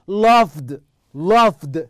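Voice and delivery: "Loved" said loudly with a clear d at the end; final D pronounced. Shown as a demonstration. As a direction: demonstrate "Loved", closing firmly on d. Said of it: "دي".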